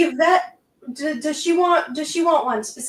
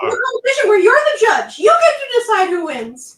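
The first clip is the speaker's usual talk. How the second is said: excited laughing; 7 semitones higher, 7 dB louder than usual. Real, not acted. Teasing her dad, laughing.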